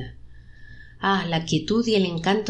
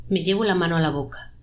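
plain reading voice

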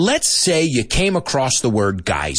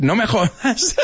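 high-pitched, breaking into a laugh; 11 semitones higher than usual. Real, not acted. Teacher amused by his British attempt, laughing. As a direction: laugh through the protest.

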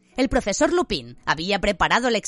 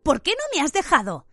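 angry; 9 semitones higher than usual. A narrator's reading. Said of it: "dijo enfadado Ron".

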